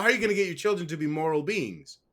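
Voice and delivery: usual speaking voice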